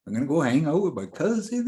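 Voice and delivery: high, fast, rushed nervous babble; flustered teen rattling off plans; panicked babble. Retold or imitated. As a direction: gabble it out in a panic.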